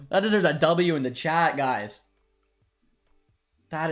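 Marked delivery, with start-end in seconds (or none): none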